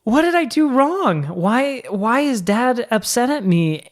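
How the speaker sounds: put-on be the little boy who doesn't know what he did; worried kid. Remembering himself as a kid.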